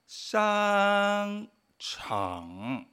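商 muy sostenido, alto y plano, 场 grave; primer tono. Shown as a demonstration. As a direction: di 商 largo arriba y baja a 场. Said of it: "primer tono". (tonos marcados despacio en 商场)

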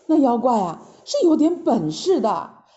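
plain reading voice

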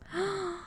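a quiet gasp of realization; soft gasp. Real, not acted. Co-host gasps realizing the lesson. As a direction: gasp as it clicks. Co-host grasps the boss's logic.

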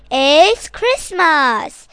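loud, slow, stretched shout. Acted. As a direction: belt it out with joy. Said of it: "Johnny Bear wakes up and shouts".

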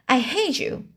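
high, lightly acted cry of hatred; mild outcry, voice lifted on "hate"; shocked cry. A narrator's reading. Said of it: "she cried in shocked voice".

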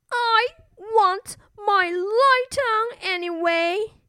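put-on high, childlike little princess; a small girl asking to keep her light on. A narrator's reading. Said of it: "said the little princess".

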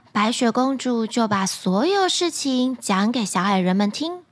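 ordinary delivery, nothing marked

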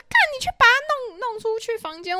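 high, shrill-pitched retold shriek of panic; mock scream. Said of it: "他就开始尖叫说".

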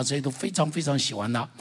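usual speaking voice

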